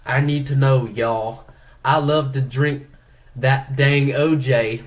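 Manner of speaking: hammed-up Southern drawl, laughing through it; mock Louisiana drawl. Reading a fan's question aloud, mock Louisiana accent. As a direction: play the letter writer in a thick Southern accent. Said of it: "growing up in Louisiana real quick".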